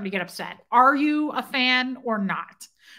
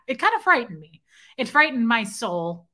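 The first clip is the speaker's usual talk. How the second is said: normal talking voice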